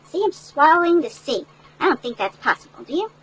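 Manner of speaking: ordinary delivery, nothing marked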